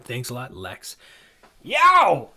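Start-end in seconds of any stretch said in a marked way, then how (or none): 1.6-2.3 high, loud startled yelp; acted cry of surprise; surprised yell. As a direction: yelp as if something just swooped overhead. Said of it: "Pardon me for yelling into the podcast".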